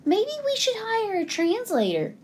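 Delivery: high, quick mouse voice; little high voice making a suggestion; another mouse. A narrator's reading. Mouse advising the other mouse, high and quick. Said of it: "said another mouse".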